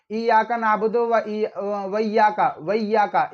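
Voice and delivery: व इय्याका run together as "waiyyaka"; short misreading of व इय्याका without its separate i; dropped alif. Shown as a demonstration. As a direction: merge व इय्याका into one word, losing the alif. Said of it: "अलिफ को उन्होंने बिल्कुल ही तर्क कर दिया".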